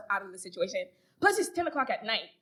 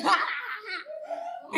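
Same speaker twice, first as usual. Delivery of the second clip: high, drawn-out mock guffaw; imitated laugh; 8 semitones higher, slower than usual. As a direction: mimic his laugh, slow and high. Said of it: "he laughs and he's like".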